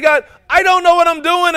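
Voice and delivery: loud, high cry of "God, I don't know what I'm doing". Acted. Preacher crying out Moses' prayer to God, loud. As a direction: belt the confession out in desperation.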